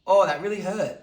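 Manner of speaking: loud, high mock yelp of pain; exaggerated wounded protest; acted hurt. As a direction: cry out as if stung. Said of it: "we go".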